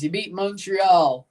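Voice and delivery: ordinary talk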